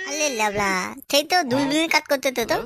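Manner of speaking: put-on baby-talk wonder, r turned to l; childish lisp, full of delight; lisping childish rascal, delighted. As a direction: marvel like a small child at a new toy. Childish figure marvels at makeshift telescope.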